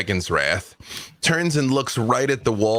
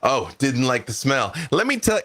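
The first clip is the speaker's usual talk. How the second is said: ordinary talk, nothing marked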